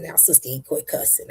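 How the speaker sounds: soft, hushed scolding grandma; a quiet mock reprimand from grandma; lightly voiced elder scolding gently; imitating Ema. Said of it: "my Ema's sitting right here saying".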